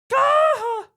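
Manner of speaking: high, loud yelped scream; Wilhelm scream imitation. Shown as a demonstration. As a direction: yelp like a movie stuntman falling. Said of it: "It sort of goes".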